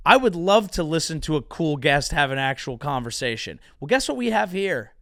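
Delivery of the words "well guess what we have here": ordinary talk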